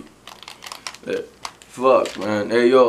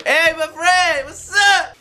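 much higher, slow, dopey hello; stoner greeting; 11 semitones higher, 3 dB louder, much slower than usual. Acted. Stoned gamer greeting the party in a high, dopey voice. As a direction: drawl a spaced-out welcome.

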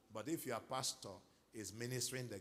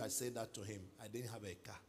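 ordinary talk, nothing marked

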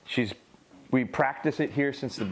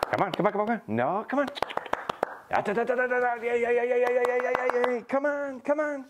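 very high, eager calling; excited dog recall; 13 semitones higher than usual. Real, not acted. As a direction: pump the dog up to come back.

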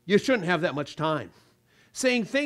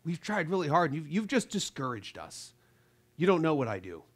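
softer, mildly wounded whine; hurt complaint; 4 semitones lower, 5 dB quieter than usual. Pretend. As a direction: play the offended listener, lightly. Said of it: "they could be".

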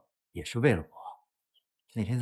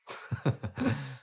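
short laugh; 4 semitones higher, 5 dB quieter than usual. Acted. Son laughs after joking with mother.

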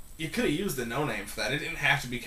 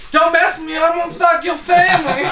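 high, loud, over-the-top thug voice; hammed-up bad-guy threat, pushed high and shouted; mock tough-guy threat; 12 dB louder than usual. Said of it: "when he go to talk, be like".

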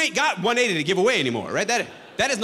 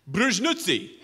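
fake Russian word; slower than usual. Faked Russian answer.

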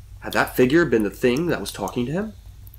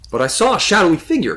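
higher, louder frustrated protest; 8 semitones higher, 5 dB louder than usual. A narrator's reading. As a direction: protest with mild exasperation, pitch raised. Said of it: "Connor said in frustration".

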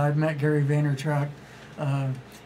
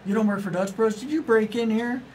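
mock disbelief; 6 semitones higher than usual. Gary Vee teasing a non-employee fan, high mock surprise. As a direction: pitch climbing higher in playful surprise. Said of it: "And he's like".

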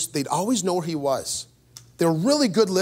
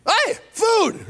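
much higher mock shout of a hungry sheep; 13 semitones higher than usual. Sheep hearing the bucket bang, shouting. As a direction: squeal it out like a greedy sheep. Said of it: "the sheep went".

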